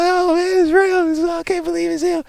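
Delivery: high, awestruck fan gushing; starstruck fan. Retold or imitated. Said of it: "Everybody's like".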